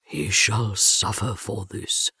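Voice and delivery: very low, slow, grim threat; grim vow. A narrator's reading. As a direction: vow revenge darkly, deep and slow. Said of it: "Romy vowed grimly".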